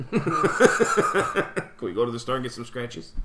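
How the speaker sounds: put-on slow, laughing old-lady plea; grandma voice. Imitating grandma asking for scratch tickets, slow and laughing. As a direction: drag it out like a begging grandma.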